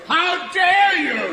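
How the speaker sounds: hammed-up Victorian fury; outraged theatrical shout. Shown as a demonstration. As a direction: draw yourself up twelve feet tall and shout it. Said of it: "They feel twelve feet tall".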